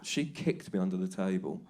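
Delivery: usual speaking voice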